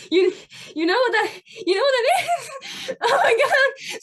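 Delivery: loud, high, building squeal; high-pitched giddy outburst, stretched at the end; overexcited disbelief. Acted. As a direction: laugh and squeal in fan amazement. Overjoyed the kid knows the band.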